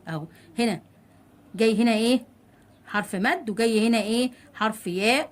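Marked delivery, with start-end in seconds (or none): none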